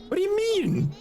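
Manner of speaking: high mock squeak; squeaky mock voice. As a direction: squeak the question like a little girl. Repeats question in silly voice.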